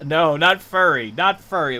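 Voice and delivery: loud, high protest through laughter; laughing protest. Real, not acted. Streamer protesting chat's mishearing, laughing objection. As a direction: laugh while firmly refusing.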